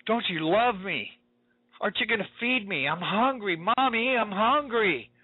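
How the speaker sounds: put-on a kid refusing the mark, whining for food; whining child. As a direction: beg Mommy for food like a hungry kid. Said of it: "These kids go".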